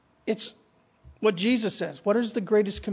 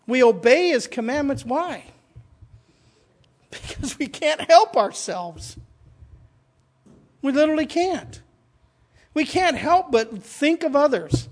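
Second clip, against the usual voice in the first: preaching at full force; 4 semitones higher, 5 dB louder than usual. Acted. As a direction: build it up like a pulpit climax. Sermon climax.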